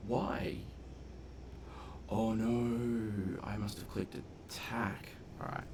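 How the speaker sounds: normal talking voice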